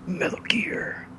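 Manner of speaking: unvoiced slow whisper; hushed, drawn-out whisper. Whispered repeat.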